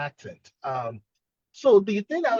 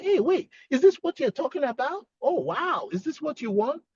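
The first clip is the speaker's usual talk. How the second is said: fun, playful; 8 semitones higher than usual. Shown as a demonstration. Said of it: "Try to make it fun for her".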